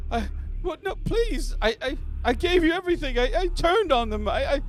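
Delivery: frightened human prisoner pleading; begging prisoner. Acted. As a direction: beg for his life in a squeaky voice. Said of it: "He's like".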